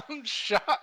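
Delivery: imitated cry of being shot, high and loud; hammed-up wounded shout; mock yell. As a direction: holler it like a soldier hit in battle, laughing. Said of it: "he was yelling that he was shot".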